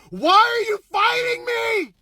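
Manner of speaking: very high, drawn-out comic scream; retold scream. Driver screaming at the vehicle, drawn-out mock scream. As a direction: scream it slowly, stretching the words. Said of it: "I scream at the vehicle".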